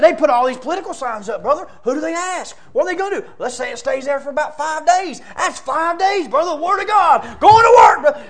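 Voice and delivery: imitating a friend selling his plan, building to a shout; urgent, rising enthusiasm; all fired up. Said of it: "He's all fired up".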